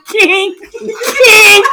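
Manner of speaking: high-pitched comic cry; hammed-up high shriek; mock screaming. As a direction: yelp it out in fake distress. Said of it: "you just start screaming".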